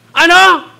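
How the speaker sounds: sudden loud, high-pitched "what?"; mock irritated outburst; snappy retort. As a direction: cut in sharply, acting short-tempered. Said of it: "Wala pang di patapos yung sentence".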